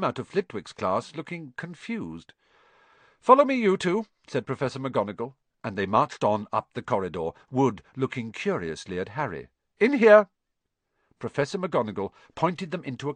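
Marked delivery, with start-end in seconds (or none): 3.2-4.1 high, loud crisp command; brisk order from a strict teacher; teacher ordering pupils along; McGonagall ordering. Acted. 9.8-10.3 high, loud crisp command; brisk order from a strict teacher; teacher ordering pupils along; McGonagall ordering. Acted. McGonagall directing boys.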